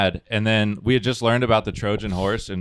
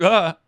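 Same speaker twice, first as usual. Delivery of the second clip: startled yelp; 10 semitones higher, 4 dB louder than usual. Retold or imitated. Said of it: "And I'm like".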